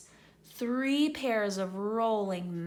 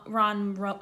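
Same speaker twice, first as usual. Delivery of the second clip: plain reading voice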